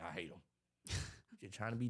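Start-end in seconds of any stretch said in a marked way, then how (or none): 0.0-0.4 low, soft mock commenter; muttered hater comment. Imitating someone commenting to be different, muttered hater comment. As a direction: drop it under your voice.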